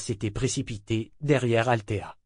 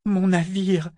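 high, tearful mumble; half sob the words; 8 semitones higher, 3 dB louder, slower than usual. A narrator's reading. Althea longing for her ship, high and half-sobbing. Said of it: "dans un demi-sanglot".

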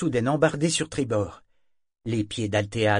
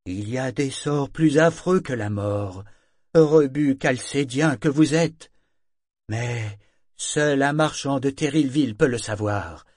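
slow, louder, bitter warning from the wrecked ship; menacing ship's voice; 4 semitones higher, 5 dB louder, slower than usual. Acted. The ship Parangon warns the men away, menacing. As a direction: loom over them, each word a threat.

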